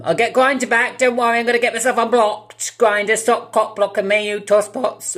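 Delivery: high, loud mock outrage; mock shouting rant. Vlogger shouting at Grindr for blocking him, mock furious. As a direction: yell at the app in hammed-up fury.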